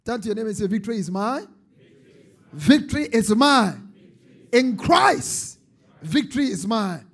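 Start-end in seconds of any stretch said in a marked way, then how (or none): 2.5-7.1 loud, emphatic congregational call; rousing declaration. Real, not acted. Preacher leads congregation in declaring victory, rousing and loud. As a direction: proclaim it loudly to the crowd.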